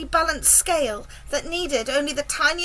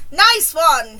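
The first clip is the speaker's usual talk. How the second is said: loud cheer of approval; shout; 2 semitones higher, 9 dB louder than usual. A narrator's reading. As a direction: call it out loudly to a teammate. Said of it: "he shouted".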